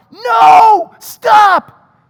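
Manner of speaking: high, loud alarmed shout of "No, stop!"; moms yelling. Retold or imitated. Moms at the playground yelling at a boy, alarmed. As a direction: yell to stop the kid jumping. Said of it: "two moms yell out".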